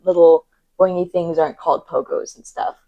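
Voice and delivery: ordinary talk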